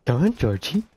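lightly voiced creepy clown; a hint of a sinister clown; Pennywise voice. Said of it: "You have a great clown voice".

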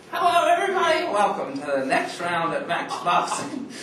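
high announcer welcome; show host voice. Acted. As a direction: open the show like a hammy TV host. Mock show host intro.

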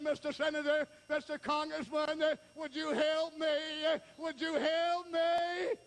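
high, pleading beggar's call; chanted pulpit plea; crying out. Acted. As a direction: call for help, stretching the endings. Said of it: "cried out and said".

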